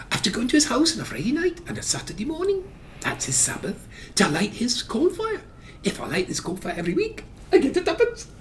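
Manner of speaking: put-on very high child voice, questioning rises; eager little lad explaining himself in a high pipe; little boy. Son explaining his job to Mum, high little-boy voice. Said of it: "a little boy".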